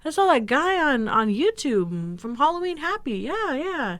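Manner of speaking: high-pitched mock-dad enthusiasm; imitating dad. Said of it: "He's like".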